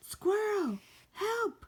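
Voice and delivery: high, far-off cry for help; calling for help. Acted. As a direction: shout for Squirrel from afar. Bear calls out for help.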